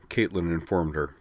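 usual speaking voice

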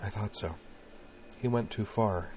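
soft, hushed remark; softly. A narrator's reading. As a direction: say it softly, with quiet understanding. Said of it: "she added softly".

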